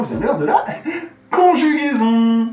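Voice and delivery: high, loud, long-drawn final syllable; mock-dramatic announcement. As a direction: proclaim it like impending doom, stretched out. Annuncio teatrale del tema temuto.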